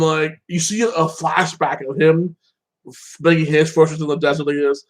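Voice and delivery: ordinary talk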